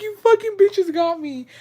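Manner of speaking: high-pitched re-enacted outburst; acting out being mad, very high. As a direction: squeal it in mock outrage, pitch way up. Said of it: "I was so mad".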